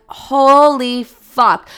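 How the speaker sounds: loud, slow, drawn-out dismay; retold horror. Server realizing pork was sent, retold horror.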